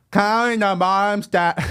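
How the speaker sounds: high, slow silly clown voice; goofy clown character; stupid clown voice. Shown as a demonstration. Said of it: "what your stupid clown voice is".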